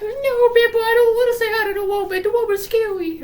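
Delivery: scared little brother refusing his bully, in a high squeaky put-on voice; high, loud, rushed mock little-kid whine; Evan. Frightened crying child telling big brother no, hammed-up and high. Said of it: "And he's like".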